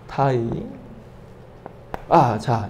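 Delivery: ordinary delivery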